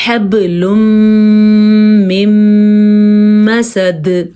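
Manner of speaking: حَبْلٌ مِّن مَّسَدٍ with نون merged into م, nasal held; idgham with ghunna. Shown as a demonstration. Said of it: "اس کو ادا کرنے کا طریقہ کیا ہے؟".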